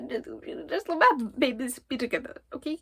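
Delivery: pleading. Real, not acted. Begging author for her ship.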